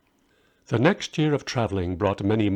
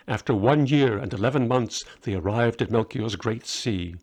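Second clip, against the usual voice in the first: usual speaking voice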